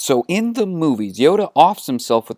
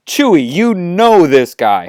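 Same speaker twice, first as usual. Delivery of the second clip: loud, raised, disbelieving question; perturbed; 5 semitones higher, 6 dB louder than usual. Acted. Han asks Chewie about Yoda, loud and perturbed. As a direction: demand an answer, thrown off balance. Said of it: "Han asks in a perturbed voice".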